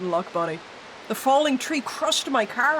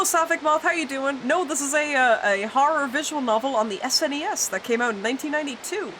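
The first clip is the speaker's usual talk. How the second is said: ordinary delivery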